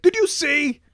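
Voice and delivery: high, eager imitation of a friend; excited retelling. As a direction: blurt it out excitedly. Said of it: "He's like".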